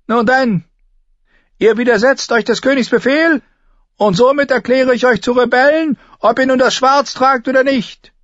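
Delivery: high, thin, loud command; very high-pitched imperious knight; Sir Amory Lodge. A narrator's reading. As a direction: order them in a piercing, thin voice.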